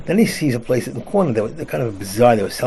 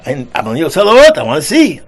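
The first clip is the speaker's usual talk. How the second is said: loud, raised-pitch insistence; shouted protest; 5 semitones higher, 13 dB louder than usual. Retold or imitated. Flashlight man answering the angry moviegoers, shouting in protest. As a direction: shout back at the complainers.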